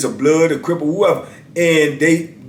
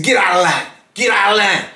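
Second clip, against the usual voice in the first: higher-pitched mock command; acted-out curt order, pitched up; retold officer's command; 6 semitones higher than usual. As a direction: imitate an officer giving a repeated order.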